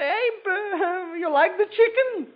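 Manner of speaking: high, sweet wifely voice, well above his own; wife's voice. Acted. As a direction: play the eager wife asking about dinner. Wife voiced in a joke.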